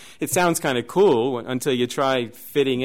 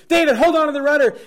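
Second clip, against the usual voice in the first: high, loud, fast yell; acting out a panicked order on a boat; urgent retold shout; 13 semitones higher, 8 dB louder, faster than usual. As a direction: call out urgently to a crewmate.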